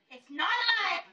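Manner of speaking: angry yell. Retold or imitated. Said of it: "So now I'm pissed".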